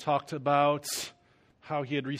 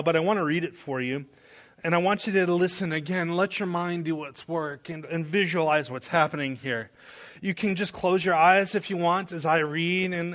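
preaching; 4 semitones higher, 5 dB louder than usual. Acted. Preacher addressing the congregation.

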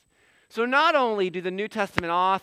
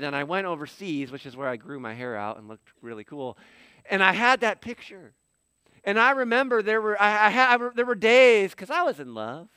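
normal talking voice

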